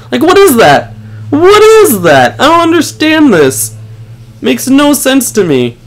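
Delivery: demanding an explanation; baffled outrage. Real, not acted. Replaying the unfair kill.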